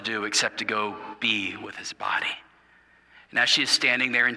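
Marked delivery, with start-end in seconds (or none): 0.0-2.3 whispered, low and sorrowful; a soft whisper for her grief; hushed whisper. A narrator's reading. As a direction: drop to a grieving whisper. Quiet retelling of Mary's grief.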